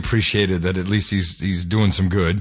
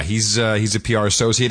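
ordinary talk, nothing marked

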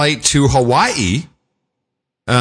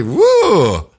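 whoop; 6 semitones higher than usual. Real, not acted. Reacting to her face.

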